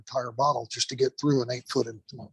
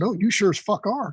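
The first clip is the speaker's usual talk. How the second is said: higher, playful retold comeback; emphatic retort; 7 semitones higher than usual. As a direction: shoot back an insistent reply, pitched up. Retold emphatic retort to nervous student.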